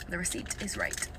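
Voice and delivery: low disbelieving mutter under the breath; quiet mutter. Real, not acted. Staring at the receipt, she mutters in disbelief. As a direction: mumble it to yourself, stunned.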